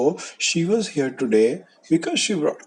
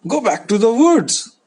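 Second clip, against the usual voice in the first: high, loud chasing-off shout; shooing away; 8 semitones higher, 7 dB louder than usual. Acted. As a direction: drive the wolf off loudly. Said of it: "they told the wolf to run away".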